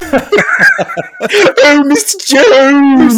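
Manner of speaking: high, loud, very slow drawn-out cry; Leonard Rossiter impression. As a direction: wail the name like a sitcom landlord. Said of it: "Richie from Bottom when he does his Leonard Rossiter".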